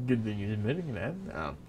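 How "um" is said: plain reading voice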